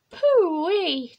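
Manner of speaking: high, loud, swooping pooh-wee; mock disgust. A narrator's reading. Daddy smelling Rabbit, loud mock disgust. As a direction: swoop it out like smelling something awful.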